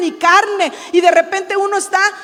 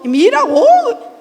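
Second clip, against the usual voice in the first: slow, drawn-out mock grumble, higher; lightly hammed-up complaint; mock complaining sigh; 7 semitones higher, 5 dB louder, much slower than usual. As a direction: imitate a whiny complainer. Mock complaint mid-sermon.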